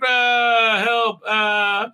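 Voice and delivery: high, wailing call for help; hammed-up distressed cry; mock help cry. Things going bish on the book ship, a mock cry for help.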